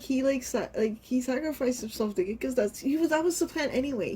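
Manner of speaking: ordinary delivery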